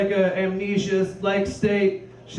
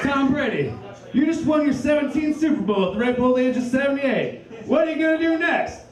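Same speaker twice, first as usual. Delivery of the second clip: higher, pumped-up sports interviewer; mock interviewer; 6 semitones higher than usual. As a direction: hype the question like a TV reporter. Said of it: "It's like".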